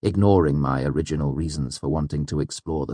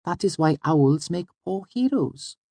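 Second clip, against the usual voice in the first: put-on very high girl character; high-pitched little sage; matter-of-fact Auri; 12 semitones higher than usual. A narrator's reading. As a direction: conclude the lesson in a high girlish voice. Auri's character voice concludes explanation.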